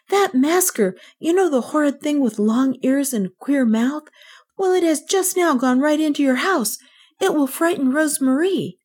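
high-pitched, earnest report; urgent child. A narrator's reading. Kay warns the maid about the masker, urgent.

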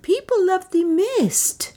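put-on high, slow voice of an old priest; cunning orator. A narrator's reading. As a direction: address the crowd in a high, drawn-out voice. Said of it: "Nam addressed them cunningly".

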